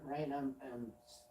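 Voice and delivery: usual speaking voice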